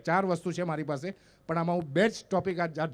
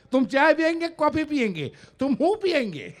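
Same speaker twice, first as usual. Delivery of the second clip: raised, louder eager hostess voice, broken Hindi; wife's broken Hindi; 7 semitones higher, 6 dB louder than usual. Retold or imitated. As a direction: play the flustered wife fumbling for Hindi words. Wife offers tea in Hindi.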